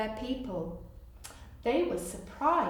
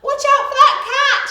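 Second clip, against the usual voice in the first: high, loud, fast warning shout; 16 dB louder, faster than usual. Acted. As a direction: yell a warning across the room. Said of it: "shouted the vet".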